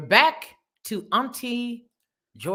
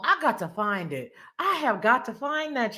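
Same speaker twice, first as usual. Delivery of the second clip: ordinary delivery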